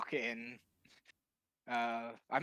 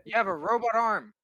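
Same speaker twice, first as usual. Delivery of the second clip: higher, louder insistence; raised-voice objection; 8 semitones higher, 7 dB louder than usual. Acted. Player disputing who counts as a cyborg, raised-voice objection. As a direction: argue it back, pitched up and loud. Bickering over being a cyborg.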